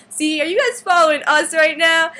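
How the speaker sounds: high, loud, hammed-up accusation; acted retort.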